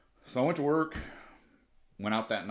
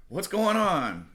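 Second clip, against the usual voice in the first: alarmed cops; 8 semitones higher, 3 dB louder, much slower than usual. Retold or imitated. Cops arrive in a panic.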